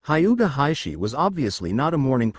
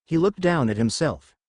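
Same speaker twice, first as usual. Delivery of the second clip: ordinary delivery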